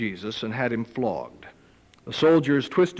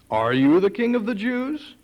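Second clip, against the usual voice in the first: 1 mock Pilate question; 8 semitones higher, 4 dB louder than usual. Pilate voiced questioning Jesus.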